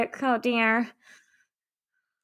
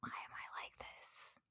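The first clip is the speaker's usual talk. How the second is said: whisper; 29 dB quieter, slower than usual. Real, not acted. Embarrassed aside after laughing fit.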